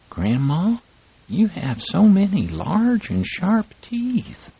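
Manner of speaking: put-on high little girl's voice, puzzled; a child asking grandma, concerned; little girl worried.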